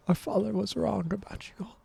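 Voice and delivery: hushed, unvoiced whisper; soft whispered aside; muttered under breath. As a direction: whisper it as a scared child muttering. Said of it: "mutter under their breath and say".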